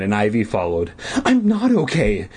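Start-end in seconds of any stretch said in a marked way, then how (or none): 1.2-2.3 tearful. Acted. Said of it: "wiping the tears off".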